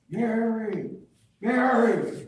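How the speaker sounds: high-pitched retold holler with drawn-out name. Fisherman calling the girl's mother after the rescue, hollering. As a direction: imitate a man calling out to someone far away. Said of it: "And he's hollering".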